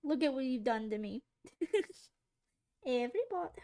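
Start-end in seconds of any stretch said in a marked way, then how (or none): 2.9-3.6 posh British woman. Retold or imitated. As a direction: stretch it out like a prim British lady. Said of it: "Who says that?".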